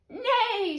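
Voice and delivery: high, falling whinny; whinny high and let it drop; horse's neigh. A narrator's reading. Horse answering the ladybird, high falling neigh. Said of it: "said the horse".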